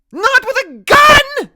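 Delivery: very high, slow, full shout; grandmother's piercing yell. A narrator's reading. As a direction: yell in protest. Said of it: "Graham yells".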